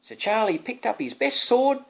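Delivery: ordinary delivery, nothing marked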